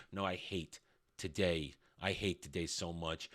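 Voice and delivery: weary groan. Real, not acted. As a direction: sink into mock despair, almost under your breath. Groans after his own joke.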